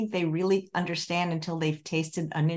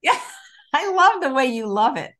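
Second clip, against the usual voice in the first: higher and louder through a laugh; 6 semitones higher, 6 dB louder than usual. Real, not acted. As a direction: laugh while saying it. Host laughs at guest's delight.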